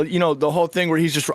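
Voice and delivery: ordinary delivery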